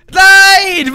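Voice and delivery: a sharp, high, loud NEIN as the run fails; frustrated shout. Real, not acted. Gamer failing a level shouts a loud, high no. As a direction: yell it out at the crash.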